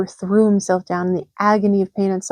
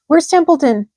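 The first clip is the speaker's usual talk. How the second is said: high, loud urgent demand; high loud demanding pig; 7 semitones higher, 6 dB louder than usual. A narrator's reading. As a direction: bark the question. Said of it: "he demanded".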